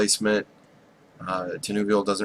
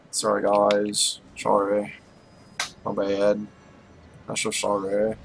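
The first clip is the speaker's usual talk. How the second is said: insincere, put-on sorry; hammed-up apologizing; mock apology; 3 dB quieter than usual. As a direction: slur a fake apology to the viewers. Fake apology, then admits not caring.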